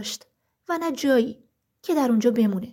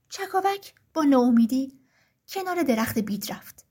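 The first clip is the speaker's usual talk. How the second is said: ordinary delivery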